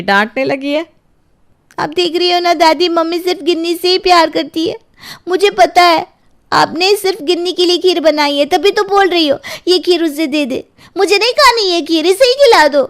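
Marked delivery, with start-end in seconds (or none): 1.8-12.8 put-on high, whiny child complaining to grandma; a young girl pleading her case, a bit higher; sulky little girl. Girl complains to grandma about her mother, whiny child voice.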